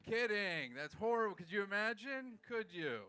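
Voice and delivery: loud, high backpedal; shouted just-kidding disclaimer; mock outrage. Real, not acted. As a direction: protest your own joke loudly. Loudly takes back a joke.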